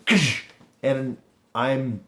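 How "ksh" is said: loud hissed "ksh" impact effect; short explosive noise of something hitting; imitated crunch. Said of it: "in my shoulder just go".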